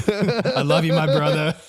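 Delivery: high, loud, laughing through the words; laughing Stone Man. Retold or imitated. Reading Stone Man's text to the hosts, laughing loudly. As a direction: gush affection while cracking up.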